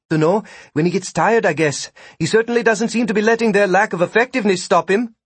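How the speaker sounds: high character voice, quickening as it goes; offhand Vikram. A narrator's reading. Vikram guessing when the arrows will stop, offhand.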